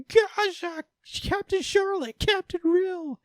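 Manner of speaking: very high, drawn-out mock wail of names; anguished cry. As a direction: cry out each name in exaggerated grief. Everyone mourns dying characters.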